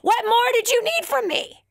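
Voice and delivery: God throwing up his hands at the man; God scolding the drowned man, hammed-up exasperation; exasperated God. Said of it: "He's like".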